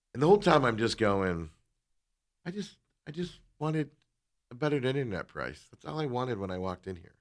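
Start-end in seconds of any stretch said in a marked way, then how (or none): 2.5-7.1 high, soft, halting complaint; put-on plaintive voice. Customer muttering to himself about the price, put-on soft voice. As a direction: complain softly in a raised voice, for others to overhear. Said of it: "all of a sudden, my voice is normal again".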